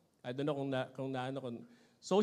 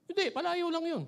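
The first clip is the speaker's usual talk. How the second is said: put-on tough kid; 11 semitones higher than usual. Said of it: "Ganun yung dating niya eh".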